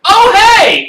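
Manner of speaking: high, loud surprised "oh hey"; startled greeting. Acted. NPC caught peeking in, blurting in surprise. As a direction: burst out in sudden surprise.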